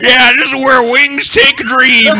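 slow, high dopey imitation; mocking Bush. Mocking a president's blunder for friends, slow high dopey voice. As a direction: ham up a dim president's folksy line. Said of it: "We're just supposed to go".

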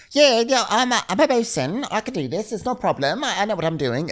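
high, airy voice, faking confidence; light mock impression of a bluffer; mock bluffing bosun. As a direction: play a bosun who's bluffing her way through. Imitating the new bosun bluffing.

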